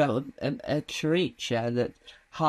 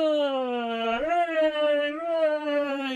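much higher, stretched fake cheering from an imaginary audience; lightly voiced hooray from the crowd; mock crowd cheer; 11 semitones higher, much slower than usual. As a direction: cheer yourself on like a pretend crowd.

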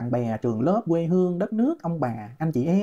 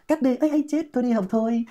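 mocking quote; 5 semitones higher than usual. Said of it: "Và cuối cùng là gì?".

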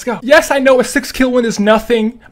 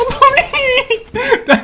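nervous laughing; 12 semitones higher than usual. Real, not acted. Nervous laugh mid-fight.

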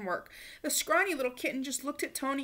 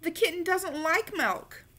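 put-on higher, slow worried child; slow disappointed report; boy telling mother; child's complaint; 6 semitones higher than usual. A narrator's reading. Tony complains to Mama.

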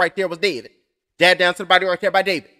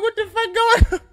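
much higher, bewildered cry; shocked disbelief, pitched way up; retold alarm; 13 semitones higher than usual. Waking up alone, retold panic, high startled cry. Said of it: "I'm like".

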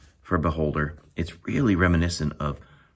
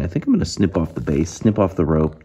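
ordinary talk

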